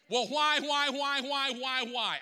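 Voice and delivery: high, loud, repeated cry of why, easing down at the end; anguished why. Acted. Pastor crying out to God at full force, anguished. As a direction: cry out why, why like a man at the end of his rope.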